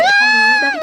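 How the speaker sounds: very high, drawn-out squeal; giddy squeal. Acted. Girl telling friend about her crush, giddy high squeal.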